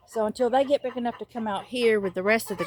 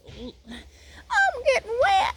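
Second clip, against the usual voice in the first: whiny complaint; much slower than usual. Real, not acted. Whining as tree drips.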